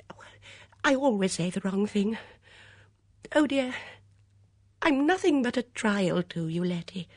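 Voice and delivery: high, distressed wail; fluttery, wretched lament; miserable outburst. A narrator's reading. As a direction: burst out self-pityingly in a high voice. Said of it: "looked miserable, bursting out at last".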